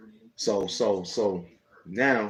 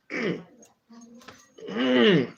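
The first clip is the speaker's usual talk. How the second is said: high, hammed-up grunting hum; imitating the friend's grunt; mock grunt; 13 semitones higher, 3 dB louder than usual. K-Dog grunting at the narrator in mock high voice.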